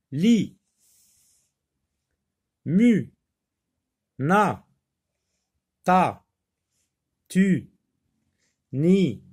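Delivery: teacher reading syllables aloud for pupils; clear syllable drill. Shown as a demonstration. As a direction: read them one by one like a drill. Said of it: "je lis des syllabes".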